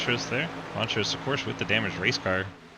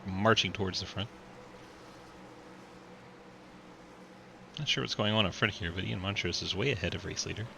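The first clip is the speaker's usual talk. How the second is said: race commentary. Acted. Live race play-by-play.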